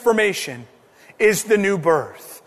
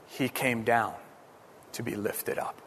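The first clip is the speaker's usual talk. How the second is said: much softer and lower, almost breathed; hushed; 10 semitones lower, 15 dB quieter than usual. Acted. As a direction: drop to a near hush on to be lifted up. Hushed sermon climax.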